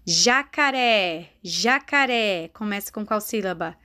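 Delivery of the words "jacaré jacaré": long, stretched syllables; teacher modelling each syllable, drawn out; slow dictation. Shown as a demonstration. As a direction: sound the word out slowly for beginners. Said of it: "fale a palavrinha".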